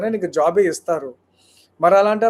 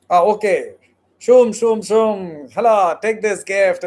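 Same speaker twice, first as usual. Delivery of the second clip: hammed-up genie voice with whooshes; a hammed-up God waving a wand to fix everything; mock magician; 6 dB louder, much slower than usual. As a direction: play a showy genie handing out a gift. Mocking magic solution from God.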